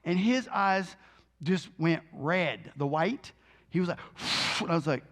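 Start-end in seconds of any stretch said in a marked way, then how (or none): 4.0-4.6 hard, noisy exhale with no voice; imitated long puff of breath; forceful blowing out. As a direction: blow out air as if expelling something. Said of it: "Just blow".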